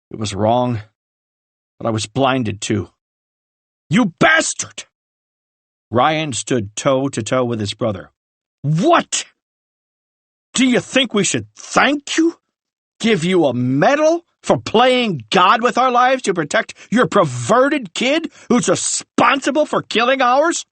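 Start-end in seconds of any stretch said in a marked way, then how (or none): 3.9-4.8 furious insult. Acted. Ryan confronts his brother. 8.6-20.7 bitter fury. Acted. Ryan confronts his brother.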